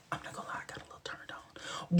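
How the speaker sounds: fast, hushed, unvoiced aside; whispered confession. Real, not acted. Admitting a crush to the audience, whispered. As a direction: let it slip under your breath.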